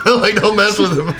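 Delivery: amused take on the vet's advice; laughing mockery. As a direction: tease the advice while laughing.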